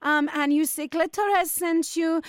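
mock Spanish hotel manager, polite but firm; fake Spanish accent. Prank hotel manager to angry caller, in a fake Spanish accent. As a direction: put on a Spanish accent and keep it courteous.

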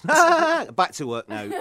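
laughing through the punchline; giggling punchline. Acted.

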